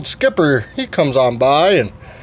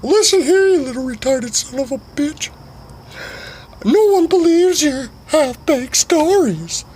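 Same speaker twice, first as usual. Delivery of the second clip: very high, squeaky angry tirade; ballistic rant. Acted. Sugar Tits going ballistic at Skipper, shrill angry squeak. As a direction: scold him in a shrill high voice. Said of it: "Sugar Tits fucking goes ballistic".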